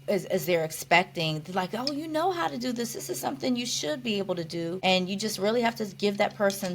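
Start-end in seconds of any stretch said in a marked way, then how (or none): 1.7-4.8 higher start, easing down, softly insistent; imitated caregiver expectation. Caregiver to recovering patient, mildly insistent. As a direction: lightly mimic someone expecting more.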